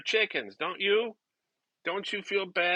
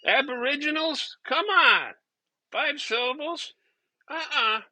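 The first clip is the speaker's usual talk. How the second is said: higher, pushy mock outrage; a higher comic rant, dismissing the long name; mock exasperation; 6 semitones higher than usual. Comic griping to the audience about long names, higher mock outrage.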